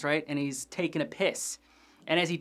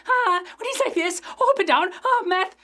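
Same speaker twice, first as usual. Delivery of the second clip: much higher, strained twitchy meth-head voice; high, loud, jittery punk voice; Stuart impression; 14 semitones higher, 6 dB louder than usual. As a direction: squeal it like a wired teen addict. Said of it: "I believe you'd have Stuart".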